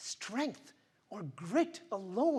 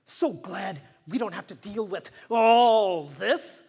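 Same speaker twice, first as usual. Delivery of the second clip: sarcastic; 11 dB louder than usual. Acted. Sarcastic false cheer.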